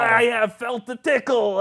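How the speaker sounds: high, loud fake voice; Adino's impostor. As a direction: cover up in a poor put-on voice. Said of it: "You sound like you're coming down".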